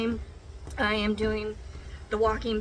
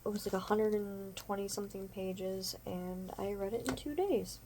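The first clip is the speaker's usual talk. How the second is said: ordinary talk, nothing marked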